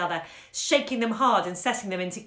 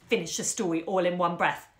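plain reading voice

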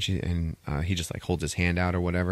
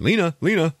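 calling for attention; 10 semitones higher, 6 dB louder than usual. Retold or imitated. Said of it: "doctor getting her attention".

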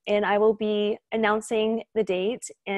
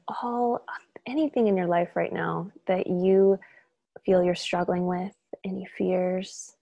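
calm, unhurried meditation guide with long pauses; soothing, even delivery, leaving space between phrases; guided meditation. Real, not acted. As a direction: gently lead listeners through breath and release.

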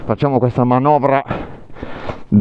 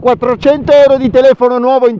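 calling out; 3 dB louder than usual. Real, not acted. Calling to riders over engines.

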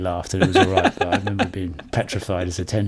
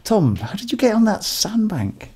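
much higher, put-on questioning voice; mock incredulous question; 12 semitones higher than usual. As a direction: play it up as a high-pitched query. Acted incredulous question to Tom.

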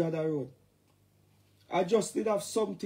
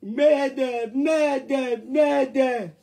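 loud, higher hammed-up distress call; mock mayday shout; 4 semitones higher, 6 dB louder, much slower than usual. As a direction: sound a mock alarm for the girls.

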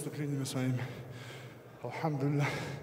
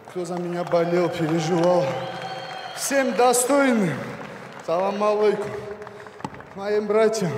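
loud shout-outs; 6 dB louder than usual. Real, not acted. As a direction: shout thanks to the crowd. Боец кричит приветы в микрофон. (громкие приветы болельщикам)

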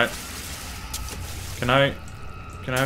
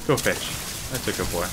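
hushed, low pet talk; cooing softly; 9 semitones lower, 8 dB quieter than usual. Acted. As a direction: whisper praise to a pet.

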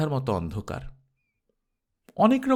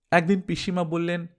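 ordinary delivery, nothing marked

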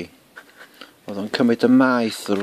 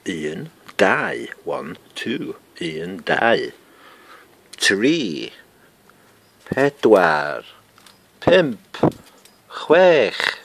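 counting in Welsh; 2 semitones higher, 7 dB louder, much slower than usual. Shown as a demonstration. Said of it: "Six is chwech in Welsh".

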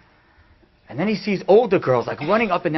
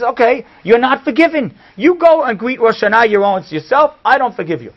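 loud, high, emphatic rebuke; indignant, raised voice; angry refusal; 7 semitones higher, 6 dB louder than usual. Retold or imitated. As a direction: throw it back loudly as a final verdict. Retold angry refusal to forgive.

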